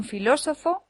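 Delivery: filósofo with the beat on LÓ, fi-LÓ-so-fo; stress on second syllable. Shown as a demonstration. Teacher lists words that differ by accent, filósofo stressed on LÓ. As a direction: punch LÓ in filósofo. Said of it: "Lo mismo ocurre con".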